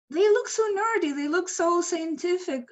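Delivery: high, incredulous aside; high-voiced bafflement; baffled reaction. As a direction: pitch it up in puzzled disbelief. Incredulous inner reaction to the boys.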